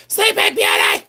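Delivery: piercingly high parody shriek; mock scream. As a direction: shriek it like an overexcited reseller. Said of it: "that's the way they say it".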